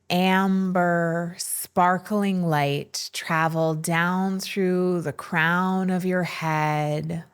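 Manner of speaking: slow, measured meditation voice with long pauses; unhurried, soothing breathwork narration; guided meditation. Acted. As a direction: guide listeners gently into stillness, leaving space between phrases.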